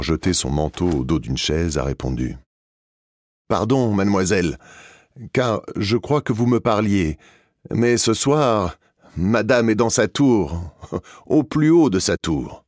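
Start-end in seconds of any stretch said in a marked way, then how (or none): 3.5-12.6 higher, slower gentle voice of a deaf lady; lightly voiced Aimée. Deaf guest answering the old lady, gentle and slow.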